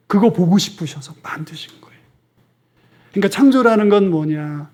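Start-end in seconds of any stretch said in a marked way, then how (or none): 0.1-2.0 whispered aside. Real, not acted. Preacher drops to intimate aside.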